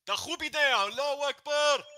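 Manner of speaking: very high, sustained mock-Arabic yell; distant Arabic yelling. Giving another take of distant Arabic yelling for a recording. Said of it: "in the distance Arabic yelling".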